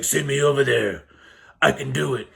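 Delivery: lightly gruff Batman-style vow; a hint of Batman, dead serious. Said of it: "I don't know why he turned into Batman".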